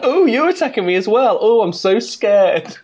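hammed-up camp taunting; camp sarcasm. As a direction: swoop up high in bitchy drag queen mockery. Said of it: "it gets really camp".

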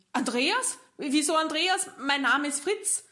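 the patient suddenly panics; alarmed surprise. Acted. Patient hears wrong name. (erschrockener Patient, höher und lauter)

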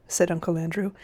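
usual speaking voice

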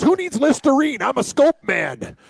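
high, slow pitchman line; mock ad pitchman. Imitating a commercial pitchman's slow, high sales line. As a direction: sell mouthwash like a cheesy commercial. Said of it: "He goes".

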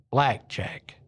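lightly voiced divine command; a hint of a heavenly voice; voice of God. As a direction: pronounce it as a terse, mysterious order. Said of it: "The voice goes".

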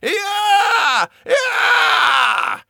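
very high inhuman screams; blood-curdling scream. Acted. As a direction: howl in sheer terror. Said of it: "inhuman and blood-curdling scream".

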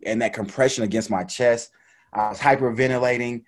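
normal talking voice

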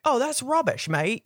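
high, hammed-up British accent as Ron; a quick, clumsy British imitation of Ron; bad British accent. Mock British Ron waving away the newspaper rumour, high and hammy. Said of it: "Ron would have been like".

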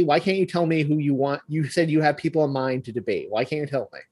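ordinary talk

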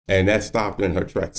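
normal talking voice

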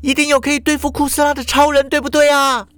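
put-on high, fast insistent girl's voice; high-pitched girl, urgent and pushing; loud question. A narrator's reading. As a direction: demand an answer. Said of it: "淑华又大声问啦".

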